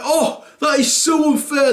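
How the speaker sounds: high, shouted mock whine; teen tantrum. Son protesting at his parents, shouted whine. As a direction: wail it like a stroppy teenager.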